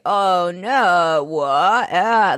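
imitating a poor attempt at lying; mock-innocent fumbling for words; bad liar. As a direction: stall with drawn-out, unconvincing denials. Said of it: "tries to lie".

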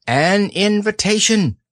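high, fussy owl; bright know-it-all bird. Acted. Owl speaking.

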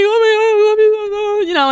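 high, loud blubbering cry of thanks; tearful overwhelmed thanks to the CEO; tearful gush. Retold or imitated. Blubbering on the phone.

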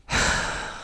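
imitated heavy exhale; huffing sigh. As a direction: huff like a stressed supervisor. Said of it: "you're just huffing and puffing all day".

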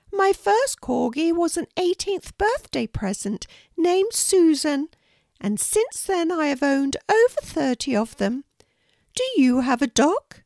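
a kindly Queen welcoming a guest, pitched well up. Acted. The Queen inviting a shy girl to tea, high regal voice. Queen speaks about dogs.

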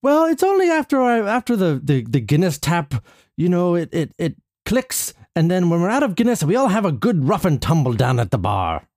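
put-on high-starting, lilting stage Irish accent; broad pub-tale Irish brogue. Patient explains bar upset to therapist, mock Irish accent. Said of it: "The Irish accent".

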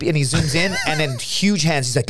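ordinary delivery

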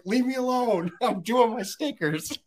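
put-on high, slow kid whine; childish whine. To wife in basement, slow childish whine. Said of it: "I was like".

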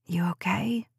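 soft worried whisper. Acted. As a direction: whisper so the boy stays asleep. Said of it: "Jade whispered".

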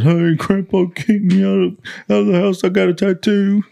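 a hammed-up tearful whine to grandma; mock crying. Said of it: "like, crying, dude".

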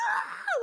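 very high-pitched short scream; retold scream. Pulling the dead mouse out, re-enacted high-pitched scream. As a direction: squeal as if handling something horrible. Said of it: "like, totally screaming".

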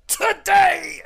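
high, loud heroic yell; defiant yell. Acted. Game master as Catherine struggling free, defiant yell. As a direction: hold back, then belt it out defiantly. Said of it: "you yell out".

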